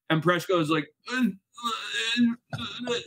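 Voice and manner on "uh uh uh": put-on high, halting drunken hesitation; imitated drunk. Drunk medic answering his teammate, imitated befuddled stammer. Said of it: "how drunk are you right now".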